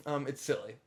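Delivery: normal talking voice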